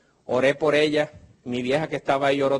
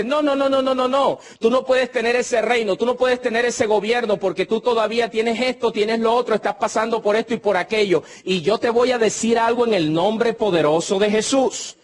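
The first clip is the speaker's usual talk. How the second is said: higher, mocking devil's voice, lightly done; the devil taunting; 6 semitones higher than usual. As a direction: sneer like the devil saying you can't have it. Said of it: "El diablo dice". (el diablo burlón, voz más aguda)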